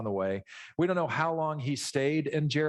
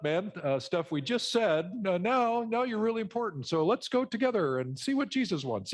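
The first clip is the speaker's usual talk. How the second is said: higher, laughing, sugary mock-apology; fake-nice crowd; 7 semitones higher than usual. Preacher mocks the crowd suddenly fawning over the beggar Bart, high and laughing. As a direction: ham up the crowd sucking up to Bart. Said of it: "the group's like".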